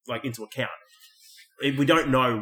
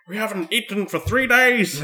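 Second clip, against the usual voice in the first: high, drawn-out whine; hammed-up grumbling while lagging behind; mock complaining; 7 semitones higher than usual. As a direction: complain it slowly, raised in pitch. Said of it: "He's just complaining the whole time".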